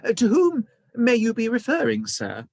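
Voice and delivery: high, loud, put-on posh hotel voice; overly refined clerk voice. A narrator's reading. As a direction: play the posh concierge for the guests. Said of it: "putting the posh voice on".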